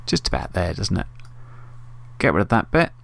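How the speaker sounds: normal talking voice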